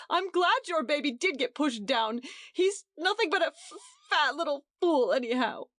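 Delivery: high, tearful and spiteful; a crying mother hitting back at the hostess; crying insult. A narrator's reading. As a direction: sob out the nasty words. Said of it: "Edith began to cry".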